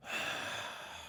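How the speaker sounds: long unvoiced sigh; weary sigh. Real, not acted. Host fed up with the episode, sighing. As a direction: let out a tired breath.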